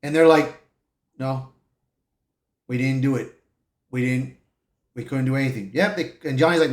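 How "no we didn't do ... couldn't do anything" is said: low, soft, subdued admission; breaking bad news. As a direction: admit failure quietly and low. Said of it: "giving the reporters the bad news".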